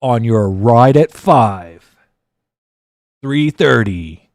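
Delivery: big on-air DJ promo; radio DJ voice. Shown as a demonstration. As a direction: stretch it out like a drive-time host. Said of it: "it's all about that major attack".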